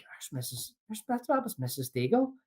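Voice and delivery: hushed, high mock disbelief; quiet, halting imitation of astonishment; stunned cops. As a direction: imitate a cop softly trailing into shock. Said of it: "they were like".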